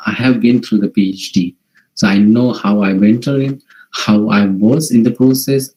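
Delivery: ordinary talk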